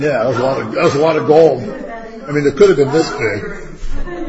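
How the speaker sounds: loud, amused astonishment; amazed exclaim. Real, not acted. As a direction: marvel at all that gold. Marveling at the huge movie calf.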